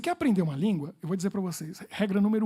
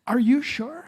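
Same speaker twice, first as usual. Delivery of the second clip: raised, louder skeptical question; doubtful American woman; 5 semitones higher, 8 dB louder, much slower than usual. Retold or imitated. An American woman doubts his odd request, skeptical. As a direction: ask like you can't believe it. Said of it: "ela falou assim".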